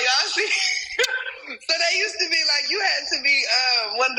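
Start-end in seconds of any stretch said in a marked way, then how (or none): 0.5-1.4 airy laugh slipping out high; high breathy giggle. Real, not acted. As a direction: let a breathless giggle escape. Laughing mid-story.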